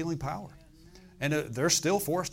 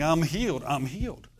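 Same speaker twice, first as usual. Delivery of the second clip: excited retold cry, only mildly raised; excited mock shouting. Preacher retelling the healed man in the temple, mildly shouted. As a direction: call it out like a joyful, restrained shout. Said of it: "shouting and screaming".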